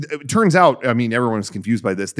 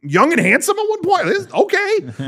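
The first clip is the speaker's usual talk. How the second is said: much higher, amazed disbelief; incredulous. Retold or imitated. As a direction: gasp in amused disbelief at the past. Amazed at young actors.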